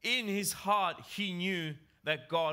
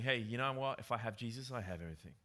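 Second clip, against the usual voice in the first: softer, settled voice of quiet contentment; contented trust; 7 semitones lower, 5 dB quieter than usual. Retold or imitated. Preacher voicing a believer who needs nothing, softly content. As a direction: let it sink in, softer and at peace. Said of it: "that attitude of saying".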